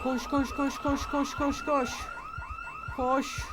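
loud urgent repeated yell; frantic yelling. Real, not acted. Player yelling at her character to run, frantic. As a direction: hurry the runner along, frantic.

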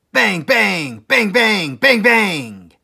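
loud barked bang bang bangs; gunshot bangs. A narrator's reading. Narrator acting the three guns firing, loud barked bangs. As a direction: blast out each bang like a gunshot.

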